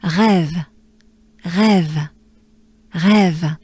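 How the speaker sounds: rêve with breathy R, short E, firm V; French rêve. Shown as a demonstration. Teacher drilling the word rêve. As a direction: breathe through rêve, end on V. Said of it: "with a V sound".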